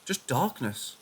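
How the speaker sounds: ordinary delivery, nothing marked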